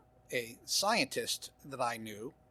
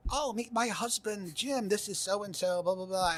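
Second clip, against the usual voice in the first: much higher, bright hostess voice; wife's cheery introduction; 8 semitones higher than usual. Retold or imitated. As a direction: imitate the wife breezily introducing her husband. Wife voiced introducing her husband.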